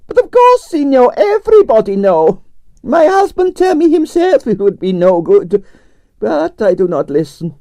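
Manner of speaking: grand Russian ballerina complaining about her night; Russian prima ballerina. Acted. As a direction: play the dramatic Russian diva. Arienskaya held voice.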